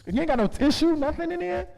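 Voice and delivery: loud, high mock interrogation; rising into a loud, high fake shakedown; getting loud. Comedian getting loud at a purse-clutching woman, loud mock interrogation. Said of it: "Now I'ma start getting loud".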